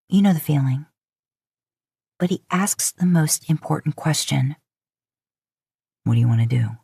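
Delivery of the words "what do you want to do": a lightly lowered man's voice, earnest; serious Dean. A narrator's reading.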